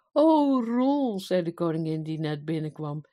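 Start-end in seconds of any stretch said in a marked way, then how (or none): 0.1-1.2 high, loud exclamation of wonder; delighted surprise. A narrator's reading. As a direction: marvel at a changed husband. Queen astonished by changed husband. (verrast en verrukt)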